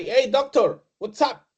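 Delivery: mock casual greeting. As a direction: toss off a too-casual hello. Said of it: "talk to with the doctor office and say".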